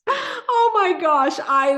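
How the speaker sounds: high, slow, squealing through laughter; laughing. Real, not acted. Host cracking up at a viewer's Packers joke, laughing. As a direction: break up at the joke.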